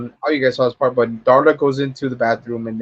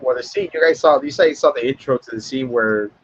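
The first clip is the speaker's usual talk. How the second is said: normal talking voice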